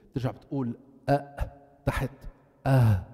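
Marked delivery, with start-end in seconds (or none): 2.7-3.0 أه breathed from the bottom of the throat; throat letter demo. Shown as a demonstration. Teacher showing the lowest exit, sounding أه. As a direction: let أه come from deep down. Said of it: "صار تحت".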